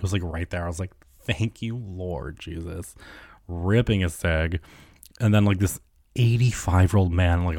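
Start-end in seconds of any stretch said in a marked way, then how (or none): 1.2-2.9 soft, slow mock prayer of relief; hushed thanks. Quietly thanking God for the smoking station. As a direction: drag out the thanks under your breath.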